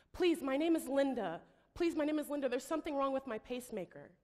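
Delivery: high, loud, desperate pleading; panicking. Retold or imitated. Woman on the emergency call pleads for help, panicking. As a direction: beg for help as if terrified. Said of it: "she's panicking".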